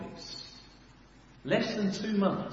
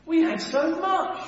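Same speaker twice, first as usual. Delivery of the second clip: grumbling Israelites; 7 semitones higher, 5 dB louder than usual. Acted. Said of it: "they're saying".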